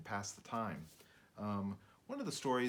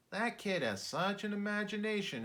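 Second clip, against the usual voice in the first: English teacher voice; 10 semitones higher than usual. A narrator's reading. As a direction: gush about the boy's imagination. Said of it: "his English teacher would say".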